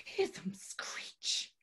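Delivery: slow spooky whisper; eerie whisper. Acted. Tarot reader summoning the spirits in a slow eerie whisper. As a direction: hiss it like the spirits are near. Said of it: "That sounded like a magpie".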